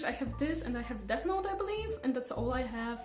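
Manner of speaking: ordinary talk, nothing marked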